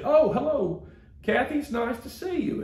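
gushing church lady. Retold or imitated. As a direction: greet her brightly, voice lifted high. Church lady greets his mother.

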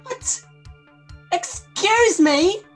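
normal talking voice

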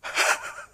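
sudden sharp intake of breath; imitated gasp of shock; acted gasp. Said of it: "He goes".

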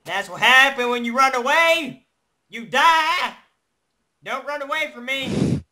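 high, loud yelling at the animal. Acted. Yelling at the fleeing pig.

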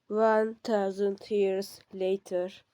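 slow, dramatic time-skip announcement; trailer narrator. Acted. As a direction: announce it like a movie caption. (yavaş, dramatik anlatıcı sesi)

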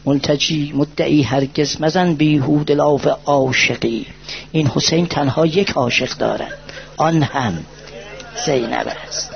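slow, low lament; mournful poem recitation. Acted. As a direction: sink into the verse slowly. Poetic lament line.

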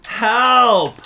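a high, loud cry for help; panicked shout. Real, not acted. Streamer shouting for help at the stuck character. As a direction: scream it out in alarm.

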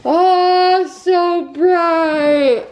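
loud, slow, sing-song daze; drawn-out dreamy exclamation; sedated. Real, not acted. Dazed patient reacting to light.

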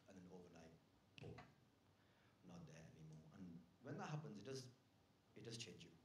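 low, hushed, grave delivery; mock-sombre confession. Comedian confiding a pandemic loss, mock-sombre and quiet. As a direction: confide a loss softly, setting up a joke. Sombre fake-out story of losing someone.

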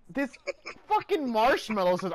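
normal talking voice

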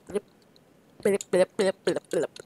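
playful blip noises; mock blips. Gamer making blip noises for fun. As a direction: blip like a toy. Imitating blipping sound.

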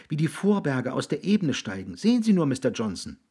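put-on high, eager boy's voice; encouraging boy. Jiggs urging an unhappy man to look around, eagerly encouraging.

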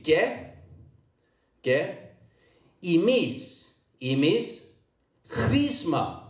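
"Ge, imis, chrisma" word by word in Cypriot Greek; original Cypriot pronunciation. Shown as a demonstration. Preacher leading congregation in repetition, "Ge, imis, chrisma" in Cypriot Greek. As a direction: teach "Ge, imis, chrisma" for repeating, with pauses between. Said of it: "the way it should be read".

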